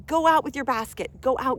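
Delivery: high, loud rallying call; excited command. Real, not acted. Urging listeners outdoors, high loud rallying call. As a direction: send them out with real enthusiasm.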